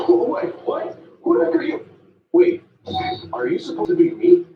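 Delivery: high, loud startled yelps; stunned and rattled, voice shooting up; shocked disbelief. Acted. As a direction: freak out at seeing yourself. Shocked at meeting his double.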